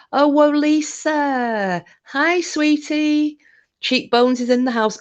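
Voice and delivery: light up as a friend arrives; excited greeting. Real, not acted. Greeting viewers joining the live, thrilled.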